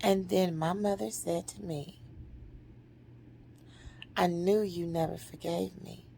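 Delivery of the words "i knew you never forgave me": low, hushed retold confession; quiet admission. Mother admits she knew she was unforgiven, quiet admission. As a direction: say it softly and low, fading.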